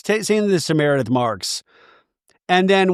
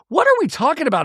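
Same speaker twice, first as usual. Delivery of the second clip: ordinary talk, nothing marked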